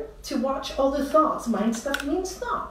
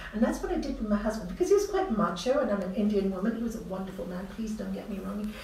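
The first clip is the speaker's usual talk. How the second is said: ordinary talk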